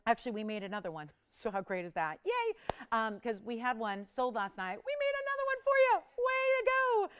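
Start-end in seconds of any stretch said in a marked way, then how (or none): 2.3-2.6 high, bright cheer; excited cheer. Real, not acted. Cheering a new piece. 4.8-7.1 high, loud cheering; excited. Real, not acted. Cheering a new piece.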